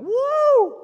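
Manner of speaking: high, loud joyful whoop; mock cheer for the good news; excited whoop. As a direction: cheer like a thrilled old father. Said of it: "instead of Zechariah going".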